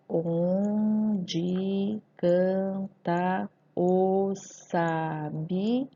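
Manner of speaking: "Onde canta o sabiá" very slow, every syllable apart, "o" on its own; normal syllable division. Shown as a demonstration. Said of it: "Eu faço uma separação silábica normal". (separação silábica normal, "o" separado de "canta")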